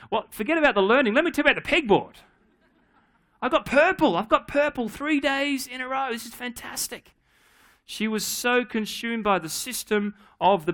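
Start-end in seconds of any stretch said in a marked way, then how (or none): 0.1-7.1 put-on high, fast, gushing little girl; excited child. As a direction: bubble over like a thrilled kid. Daughter excited about pegboard.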